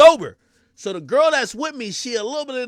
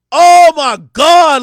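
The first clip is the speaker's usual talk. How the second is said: loud, high, drawn-out disbelief; shocked outcry; 8 semitones higher, 18 dB louder, much slower than usual. Retold or imitated. Man watching his date get liquor poured, shocked outcry.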